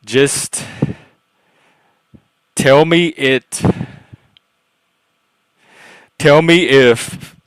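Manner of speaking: lightly put-on bro, slow and emphatic; loud, stretched-out words with a hint of bro. Shown as a demonstration. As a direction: punch it out loudly in a bro voice. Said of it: "when I talk in my bro voice".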